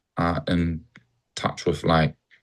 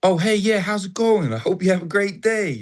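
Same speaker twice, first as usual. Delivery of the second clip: wholehearted American greeting; 10 semitones higher than usual. Retold or imitated. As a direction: greet a stranger as if delighted to see them. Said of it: "But in America, it's like".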